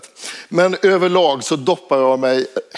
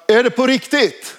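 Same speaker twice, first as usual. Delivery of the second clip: higher, calling across the water; 8 semitones higher than usual. Retold or imitated. Narrator calls out to the swimmer, a retold call across the water. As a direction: shout the question out to the boy. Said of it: "Och ropar".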